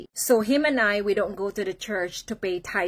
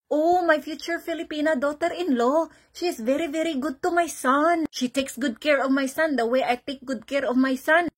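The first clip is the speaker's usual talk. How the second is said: higher, put-on mother-in-law voice; proud Indian mom; 6 semitones higher than usual. Mom praising her future daughter-in-law to friends, high proud voice. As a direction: boast like a doting Indian mother, pitched up. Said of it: "she would start telling her friends".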